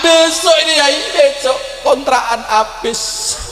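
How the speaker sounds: high mock wail, slowing down; imitated desperate lament. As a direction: plead tearfully as a man in trouble. Layman laments his overdue rent. (menirukan keluhan memelas)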